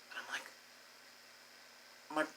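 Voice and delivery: breathy hushed aside; whisper. Real, not acted. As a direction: drop to a whisper. Sudden whisper in story.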